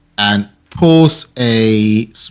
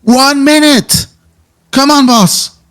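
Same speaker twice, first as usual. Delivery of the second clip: high-pitched, drawn-out distant call; yelling out; slower than usual. Acted. Woman calling her boss from the cars, high yell. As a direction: call out high, stretching the words. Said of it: "As she yells out".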